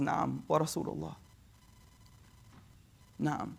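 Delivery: low, very soft admission; hushed confirmation. Retold or imitated. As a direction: confirm the bad news quietly and heavily. Mother reluctantly confirms bad news.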